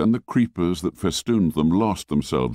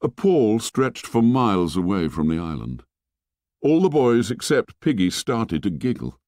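usual speaking voice

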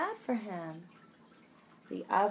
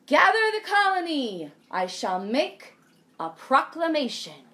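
loud, high, booming king; heroic regal voice; 6 semitones higher, 6 dB louder than usual. A narrator's reading. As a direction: proclaim it like a regal hero. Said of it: "in a heroic, regal voice".

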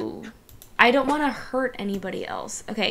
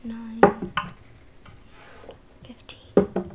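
1 whispered count; 6 dB quieter than usual. Real, not acted. Counting dice under breath.

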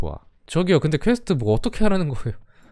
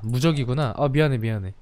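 ordinary talk, nothing marked

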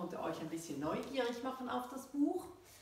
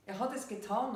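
louder, higher cry into the phone; calling out; 4 semitones higher, 6 dB louder than usual. A narrator's reading. Girl calls out the bad news to her friend, urgent. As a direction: blurt it out urgently into the speakerphone. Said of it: "rief ich in den Lautsprecher".

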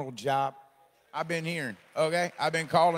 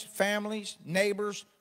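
normal talking voice

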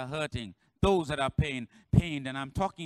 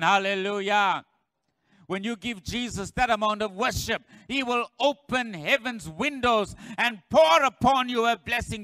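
loud, high preaching cry to the church; full-force preaching; 4 semitones higher, 7 dB louder than usual. Acted. Pastor to congregation, loud high preaching cry. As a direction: pour it out like a revival preacher.

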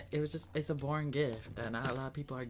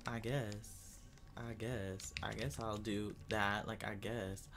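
reluctant mutter; 6 semitones lower, 9 dB quieter, slower than usual. Real, not acted.

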